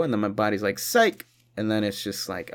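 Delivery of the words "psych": high teasing psych; mock psych. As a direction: pull it away with a high taunt. Body voiced teasing hand-off.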